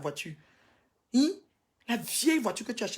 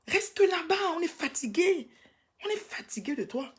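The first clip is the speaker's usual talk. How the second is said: exasperated outburst; 9 semitones higher than usual. Real, not acted. Vlogger tells her rival to stay away, exasperated. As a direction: push it out high, at the end of your patience.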